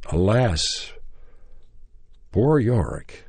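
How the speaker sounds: measured theatrical lines with long gaps; halting recitation. Retold or imitated. Nervous actor starting his audition speech, halting recitation. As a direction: declaim it word by word, pausing as if unsure.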